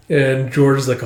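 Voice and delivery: usual speaking voice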